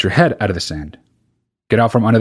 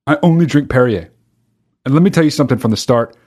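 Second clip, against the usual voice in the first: ordinary talk, nothing marked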